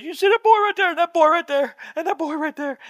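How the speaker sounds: high-pitched mock-tearful woman pointing people out; imitated tearful cry. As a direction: imitate her crying, voice way up high. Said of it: "and she's crying".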